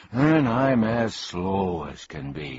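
slow, drawn-out grumble; a mock whine, slowly; slow complaint. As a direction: drag the words like a snail. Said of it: "he complained".

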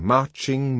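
plain reading voice

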